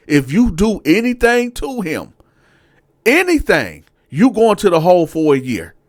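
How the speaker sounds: high, loud warning with a pause; stern threat. Retold or imitated. Sergeant warning Dante not to touch his cellmate, high and loud. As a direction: threaten him with the hole. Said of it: "So he was like".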